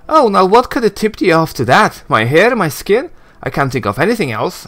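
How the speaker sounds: high, mocking sarcasm; sarcastic Anna. Anna's voice after narration.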